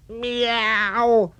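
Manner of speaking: high, drawn-out meow; a cow trying to be a cat, mew it high. Acted. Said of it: "said the cow".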